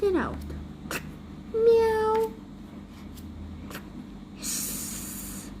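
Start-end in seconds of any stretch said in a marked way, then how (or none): 1.5-2.3 high meowing cat. Acted. Testing the cat suit with a high meow. 4.4-5.5 whispered hiss. Acted. Cat invention test.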